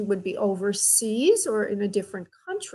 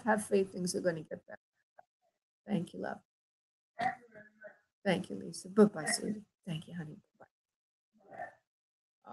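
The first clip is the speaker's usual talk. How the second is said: fading goodbye; 2 semitones lower, 8 dB quieter than usual. Real, not acted. Medium soothing, signing off caller.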